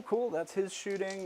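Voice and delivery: ordinary talk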